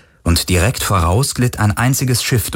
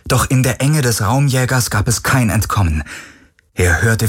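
plain reading voice

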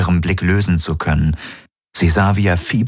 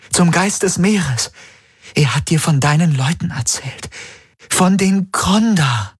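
high, rising, desperate plea; pleading young woman; 11 semitones higher than usual. Acted. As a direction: beg him to remember. Kirai keeps pleading.

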